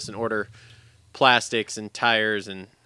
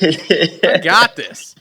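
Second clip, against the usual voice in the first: mock bravado; 6 semitones higher, 9 dB louder, much slower than usual.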